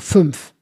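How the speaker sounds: fünf with an M, "fümf". Retold or imitated. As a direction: close fünf on MF. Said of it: "fünf ist am Niederrhein definitiv ein M".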